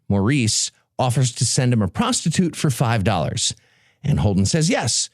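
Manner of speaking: normal talking voice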